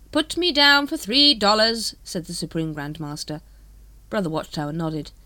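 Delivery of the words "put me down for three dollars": slow, louder, grudging; grudging pledge. Acted. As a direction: pledge as if it pains you. Grand Master pledges money.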